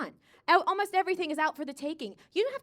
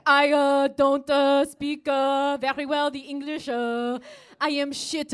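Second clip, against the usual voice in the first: put-on nervous French accent; 4 dB louder, slower than usual. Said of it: "you're like".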